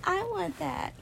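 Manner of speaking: high, slow, wistful; high, wistful envy of a happy friend; envious longing. Retold or imitated. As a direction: pine for it up high. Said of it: "and I'm like".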